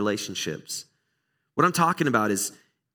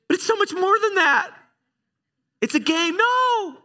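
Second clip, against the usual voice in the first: very high, louder hammed-up outrage; mock outrage; 4 dB louder than usual. Preacher mocking his own soccer-game overreaction, hammed-up high outrage. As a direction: ham up the overreaction like it's life or death.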